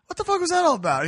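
high-pitched retold disbelief; mock-astonished demand for an explanation; incredulous. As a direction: shoot the voice way up in bafflement. Retold baffled question to friend.

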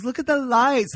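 high-pitched gushing imitation; hammed-up awe at pretty lights; mock childlike wonder. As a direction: imitate his sudden delight, voice way up. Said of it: "gleaming out the window".

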